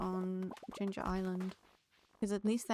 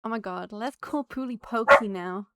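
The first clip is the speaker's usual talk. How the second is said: much louder, startling exclamation; sudden loud outburst; 11 dB louder than usual. Real, not acted. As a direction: blurt it out loud enough to jump-scare a friend. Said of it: "for the jump scare".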